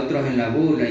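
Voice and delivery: ordinary delivery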